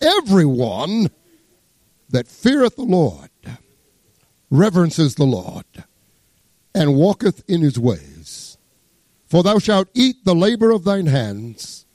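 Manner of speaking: slow, solemn Bible reading; scripture reading. Acted. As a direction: lift the old words slowly. Reads scripture aloud.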